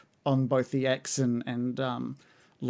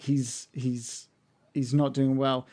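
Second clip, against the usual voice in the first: ordinary talk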